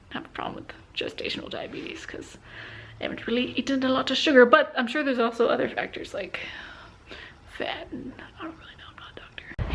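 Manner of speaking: barely audible whisper, no voice; soft confiding whisper, words trailing off. Real, not acted. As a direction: whisper it like you might be overheard. Hushed aside in a quiet place.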